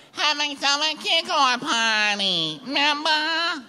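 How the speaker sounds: very high, drawn-out mimicking voice; mock quarrelling woman. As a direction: remind him in a slow, piercingly high put-on voice. Acted quarrel, woman reminds Louis.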